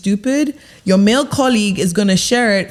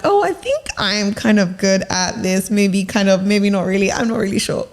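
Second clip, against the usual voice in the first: hesitant, wavering self-doubt; unsure. Shown as a demonstration. Coach mimicking a worker underselling herself, unsure and hesitant. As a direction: hedge it, as if you doubt yourself. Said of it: "you don't wanna be like".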